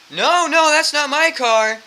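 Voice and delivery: high, loud, drawn-out mock protest; exaggerated denial. Drunk man denying the car is his, exaggerated protest.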